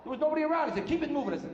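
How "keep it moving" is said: high, hurried mock bark; brusque direction-giver. Airport man directing a lost driver, high and brusque. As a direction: rattle off orders in a raised, impatient pitch. Same man repeats his order.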